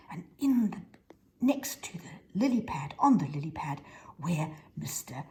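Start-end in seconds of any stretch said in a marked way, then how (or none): none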